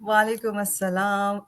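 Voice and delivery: normal talking voice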